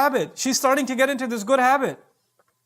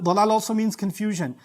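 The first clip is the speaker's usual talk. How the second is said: normal talking voice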